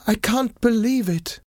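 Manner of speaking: very high-pitched disbelief; astonished cry. A narrator's reading. Wife seeing her husband tied up, amazed cry. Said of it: "she cried".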